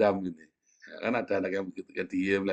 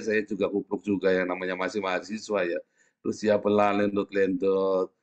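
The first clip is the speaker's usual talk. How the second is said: ordinary talk, nothing marked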